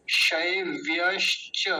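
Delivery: शैव्यश्च slowly, broken as शै-व्-यश्-च; careful pronunciation demo. Shown as a demonstration. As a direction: draw out शैव्यश्च so every sound is heard. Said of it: "गलती होती है इसमें". (शैव्यश्च धीरे, हर ध्वनि अलग)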